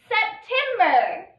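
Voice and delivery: September, drawn out slowly syllable by syllable; slow blended reading. Shown as a demonstration. Teacher blending for the class, slow and clear. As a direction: stretch out "September" so the class can follow each part. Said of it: "Read this word with me".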